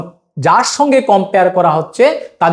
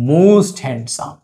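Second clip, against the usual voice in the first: very slow, stretched out for students to copy; slow dictation; 3 semitones lower, much slower than usual. Shown as a demonstration. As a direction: draw out each word like a teacher dictating. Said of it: "আর কি করতে পারি সুপারলেটিভে?". (খুব ধীরে উচ্চারণ)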